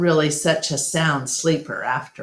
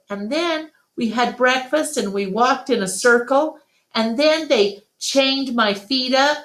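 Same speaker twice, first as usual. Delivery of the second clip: put-on higher child voice, sighing at the end; little Ruby remembering; 8 semitones higher than usual. Ruby telling Ivan about her cruel old circus. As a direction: tell it like a small sad child.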